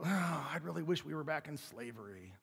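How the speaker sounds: soft, sighing moan of complaint; mock grumbling. Preacher playing Israelites in the wilderness, mock grumbling. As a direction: groan it under your breath like a malcontent. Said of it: "They're grumbling".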